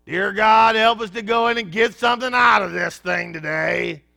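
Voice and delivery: a hint of a loud churchy prayer, hammed-up; mock pious prayer. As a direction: send up the pray-er with a loud fervent plea. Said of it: "somebody prayed this before church".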